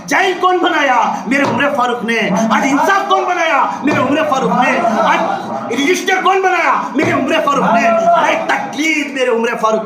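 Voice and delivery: high ringing questions, forceful answers; impassioned preaching. Real, not acted. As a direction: fire questions at the crowd and answer them.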